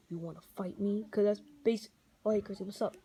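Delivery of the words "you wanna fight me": low, quiet gruff growl; a lightly growled tough-guy voice; wrong Daredevil voice. Shown as a demonstration. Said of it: "Daredevil doesn't really sound like this".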